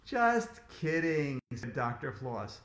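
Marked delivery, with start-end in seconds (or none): 0.1-1.4 slow, drawn-out playful reassurance; teasing. A narrator's reading. Dentist admits the joke to AJ, teasing. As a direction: stretch it out, letting the joke show. Dentist teases the screaming boy.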